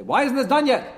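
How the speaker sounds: high, loud demand at the Rebbe; impatient demand. Retold or imitated. King calling in his Rebbe to demand results, loud. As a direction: snap the question like a boss. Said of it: "A chutzpah is that".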